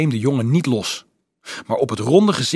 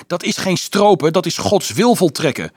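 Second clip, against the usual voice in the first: high, loud indignant yell; shrieking; 9 semitones higher, 6 dB louder than usual. A narrator's reading. Carolus defending hunting as God's will, shrieking. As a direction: cry out in protest. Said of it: "gilde Carolus".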